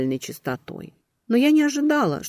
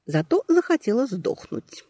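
plain reading voice

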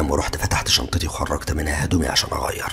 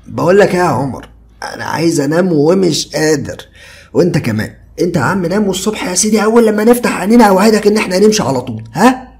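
higher, louder soothing buddy; coaxing Ziad; 14 semitones higher, 8 dB louder than usual. A narrator's reading. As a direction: talk him down lazily. Ziad coaxing friend to sleep.

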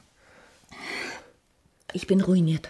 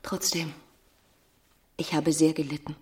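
much softer, hurt; wounded hush; 3 semitones lower, 7 dB quieter than usual. Acted. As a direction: quietly lay the hurt on him. Emma reproaches Rodolphe.

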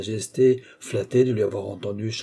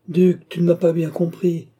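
higher, louder cry of protest; exclaimed protest; 7 semitones higher, 6 dB louder than usual. A narrator's reading. Baron stopping the departing duke, exclaimed protest. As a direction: call out to stop him leaving. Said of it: "s'écria le baron en l'arrêtant".

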